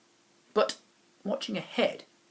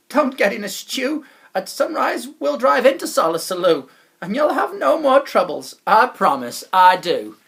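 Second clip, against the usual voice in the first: loud, higher, hearty salesman voice; jolly wobble chap; 7 semitones higher, 11 dB louder than usual. Acted. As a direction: sell the dream of Solosaloo. Chap reassures boy.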